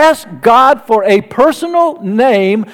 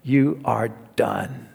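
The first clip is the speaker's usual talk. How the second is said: much lower and far softer, nearly under the breath; a hushed, pitying aside; Pharaoh's thought; 11 semitones lower, 13 dB quieter than usual. Retold or imitated. Pharaoh privately pities Moses, low and hushed. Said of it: "Pharaoh would think to himself".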